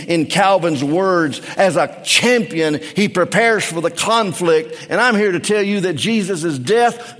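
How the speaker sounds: high, driving sermon delivery; impassioned preaching. Acted. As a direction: preach with mounting fervor. Preaches with rising fervor.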